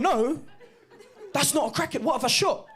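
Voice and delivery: high, startled whine, "what have I shot"; startled dad. Retold or imitated. Dad on a hunt, startled at his own shot, high and alarmed. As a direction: act out the panic of a wrong shot. Dad realising wrong target.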